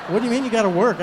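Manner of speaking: a father laughing at his son's flimsy excuse; laughing disbelief. Retold or imitated. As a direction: call out the obvious excuse.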